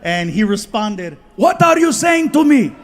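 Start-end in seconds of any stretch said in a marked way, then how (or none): 1.3-2.7 louder, higher indignant snap; offended vendor. Retold or imitated. Greek vendor offended at being greeted in Spanish, indignant. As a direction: demand to know what he said.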